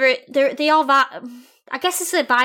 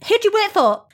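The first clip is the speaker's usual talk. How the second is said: confronting demand; 10 semitones higher, 4 dB louder than usual. Pretend. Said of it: "go up and say".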